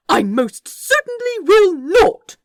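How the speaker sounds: loud, high, firm refusal. Acted. The angel firmly refuses the orangutan, loud and high. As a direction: put your foot down hard. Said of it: "he said firmly".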